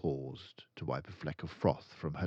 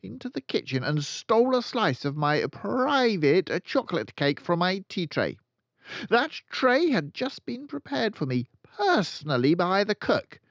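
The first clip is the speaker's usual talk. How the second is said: high, loud, ranting headmistress; furious Trunchbull; 13 semitones higher, 10 dB louder than usual. Acted. Headmistress accusing a boy of stealing cake, loud rant. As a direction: bellow the accusation like a tyrant.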